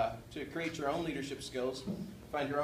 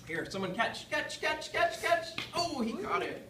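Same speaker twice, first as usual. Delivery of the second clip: calling out; 13 semitones higher, 4 dB louder, slower than usual. Real, not acted. Tossing fruit to the audience.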